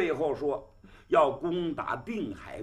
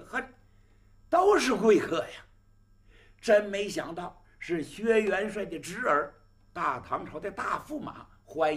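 delighted host; 5 semitones higher, 4 dB louder than usual. Acted. Host greets honored guests.

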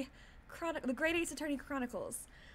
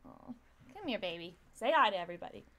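tender pet talk; 6 semitones lower than usual. Real, not acted. Streamer calling Minda over, tender.

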